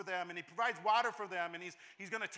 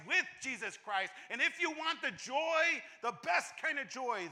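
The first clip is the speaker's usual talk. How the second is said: louder, higher, building preacher's climax; preaching at full force; 6 semitones higher, 6 dB louder than usual. Acted. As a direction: drive it home to the congregation.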